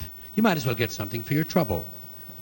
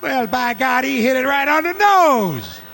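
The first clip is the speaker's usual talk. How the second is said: amazed clerk; 9 semitones higher, 6 dB louder, slower than usual. Acted.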